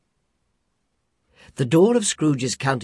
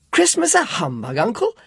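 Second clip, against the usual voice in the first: surprised question; 9 semitones higher, 4 dB louder than usual. A narrator's reading.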